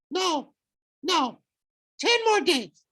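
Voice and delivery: very high wheedling falsetto; bargaining falsetto. Acted. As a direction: haggle in a squeaky falsetto.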